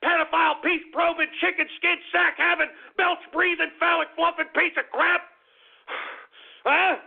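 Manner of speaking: relentless, insult-piling rant; angry tirade. Real, not acted. Host berating viewers who want the show ended, angry tirade. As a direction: rattle off the insults in rising exasperation.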